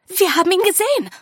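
high, fast, loud blurt; bursting out. A narrator's reading. Sophie reporting to Lady Lesso what they saw, bursting out. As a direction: let it burst out urgently. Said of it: "brach es aus Sophie hervor".

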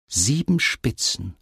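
slow, drawn-out counting; abzählend. A narrator's reading. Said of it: "sie zählte". (langsam abgezählt)

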